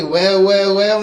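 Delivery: mock incredulous repeat. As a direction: throw the line back in disbelief. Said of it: "Can't say".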